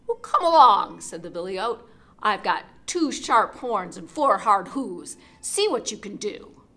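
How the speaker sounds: loud, defiant goat; bold challenge. Acted. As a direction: dare him loudly. Big goat challenges troll.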